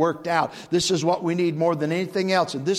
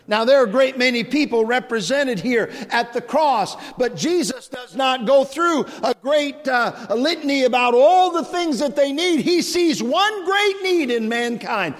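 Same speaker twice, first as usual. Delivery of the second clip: rising preaching; 7 semitones higher, 4 dB louder than usual. Real, not acted. Preacher lifting his voice to the crowd, rising fervor. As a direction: pitch climbing higher and louder through the run.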